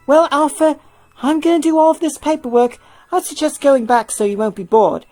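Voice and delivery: trusting zookeeper, lightly acted; Hilda voiced. Hilda to Alpha about the new staff, lightly voiced.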